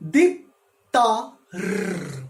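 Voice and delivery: "Dip", "Ta", "Rr" as separate sounds, the r trilled; split into syllables. Shown as a demonstration. Teacher showing where sound gets blocked, syllables split apart. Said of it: "it's getting blocked somewhere".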